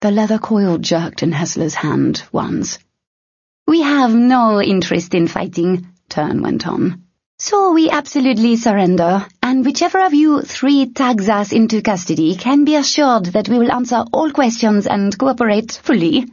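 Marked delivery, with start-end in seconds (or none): 3.7-5.9 high, eager to please; earnest surrender; glib thief voice. A narrator's reading. Tern surrenders. 7.4-16.3 high, overly eager promise. A narrator's reading. Tern surrenders.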